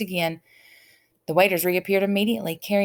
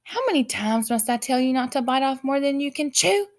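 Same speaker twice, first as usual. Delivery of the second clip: sharp higher snap; snapping; 7 semitones higher than usual. A narrator's reading. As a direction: scold him curtly. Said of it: "snapped the undersecretary".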